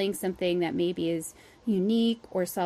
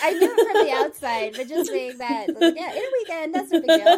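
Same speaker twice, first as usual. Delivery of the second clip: real laughter through the words, high and loud; loud, high-pitched, laughing tease; 9 semitones higher, 7 dB louder than usual. Real, not acted. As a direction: talk through a big laugh. Both laughing over the weekend deadline.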